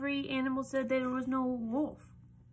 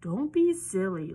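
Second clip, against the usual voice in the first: dad voice; 2 semitones lower, 7 dB louder than usual. Acted. Dad laughing at piglet.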